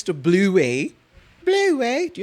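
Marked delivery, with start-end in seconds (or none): 1.4-2.1 high-pitched Australian-accented cartoon name; playful, high Australian mimicry. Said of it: "infiltrated in the Australian accent".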